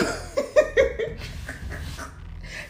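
airy giggle up high; a high unvoiced cackle; breathy high laugh. Real, not acted. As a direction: let a whispered laugh escape. Laughs after the mock lines.

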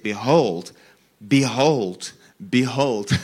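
"behold" punched out loud, set apart by pauses; emphasis on BEHOLD. Real, not acted. Preacher reading the seraphim's words to listeners, emphatic. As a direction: hit each "behold" hard and separately. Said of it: "like to place emphasis on certain words".

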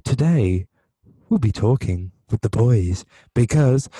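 airline pilot announcing to the cabin; pilot announcement. Acted. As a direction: announce it like a pilot over the intercom. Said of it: "started off as an air pilot announcing".

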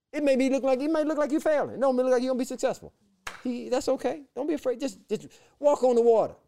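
pushing listeners to step out in faith; fired-up exhortation. Real, not acted. Exhorting congregation.

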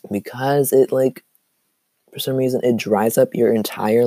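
ordinary talk, nothing marked

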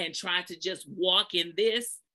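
ordinary delivery

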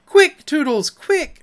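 shrill, high, loud urging; piercing fairy cry; screamed. A narrator's reading. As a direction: urge him on in a high shriek. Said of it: "she screamed".

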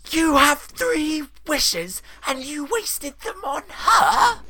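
slow, shrieking high scolding; hammed-up falsetto fury; outraged pantomime dame. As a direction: wail each word at your wasteful son. Dame scolds her son.

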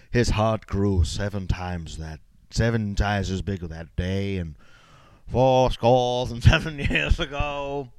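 slow, hammed-up recitation in a put-on voice; grand joking oration that breaks into a laugh; mock storybook narrator. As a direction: recite it grandly, drawing out the words.

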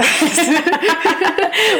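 high, breathless giggle fit; burst of laughter. Real, not acted. Laughing at her own week recap.

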